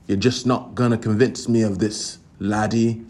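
put-on light Scottish accent, barely shifted; a hint of a Scottish brogue on laddie; lightly voiced Scottish accent. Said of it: "three different accents in that".

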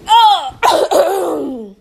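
ordinary talk, nothing marked

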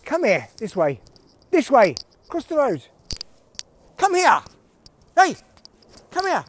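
high, loud calls to a dog; calling the dog. Real, not acted. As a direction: call out sharply to a dog across a road.

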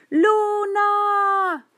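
high, long-drawn shout; calling out. A narrator's reading. Girl reaching toward a distant light, drawn-out call. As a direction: cry it out high, holding the vowels. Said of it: "Alargó los brazos y gritó".

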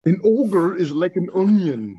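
a slow, rolling Scottish brogue like the ogre; Scottish accent on request, deliberate and drawn out; Shrek's Scottish accent. Pretend. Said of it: "give us the Scottish accent, [name]".

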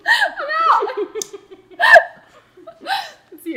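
high, loud laughing shrieks; helpless squealing laughter; laughing hard. Real, not acted. Cracking up at the game.

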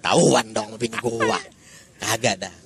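put-on Jakarta Betawi street accent. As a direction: talk like a Betawi local. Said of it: "ngomong kayak orang Betawi ya".

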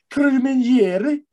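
laugh, then trill the R's in Carmeniere; rolled R. Shown as a demonstration. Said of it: "You roll your R's".

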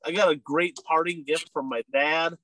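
ordinary talk, nothing marked

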